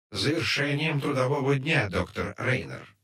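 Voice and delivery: cold, metallic automated voice; metallic computer voice. A narrator's reading. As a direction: sound like an irritating station computer. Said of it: "раздражающий металлический голос".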